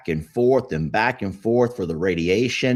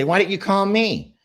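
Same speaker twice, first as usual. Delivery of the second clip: high, slightly louder mock whine of a wife; wife complaining; 11 semitones higher, 4 dB louder than usual. Husband mimics wife scolding about skipped lunch, mock whine. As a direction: play the annoyed wife, pitched up. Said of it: "'cause she's gonna say".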